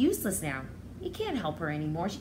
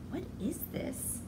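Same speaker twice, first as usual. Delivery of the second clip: curious whisper; 8 semitones higher, 6 dB quieter than usual. Acted. Curious child in story.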